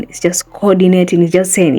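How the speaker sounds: ordinary delivery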